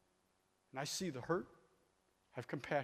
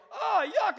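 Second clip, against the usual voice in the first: high, loud hammed-up yuck; loud disgusted yuck; 14 dB louder than usual. Man tasting warm hose water, loud disgusted yuck. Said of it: "you go".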